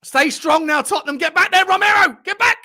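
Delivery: high, loud yells at the players; shouting at the screen. Real, not acted. Fan yelling at Tottenham players during the match, loud shout. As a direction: bellow it at the TV from the sofa.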